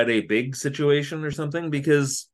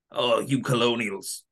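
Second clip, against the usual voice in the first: put-on English accent. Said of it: "the accent that he used".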